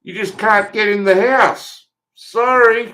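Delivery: slow, high, sarcastic fake apology; mock-polite sarcasm. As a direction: drag it out slowly, sweetly mocking him. Mocking the census man.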